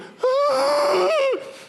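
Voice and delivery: loud, high, shrieking gasp; panicked gasp. Acted. Meme reaction, panicked gasp.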